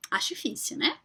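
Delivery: soft whispered doubt; whispered aside. Real, not acted. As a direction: lean in and whisper it.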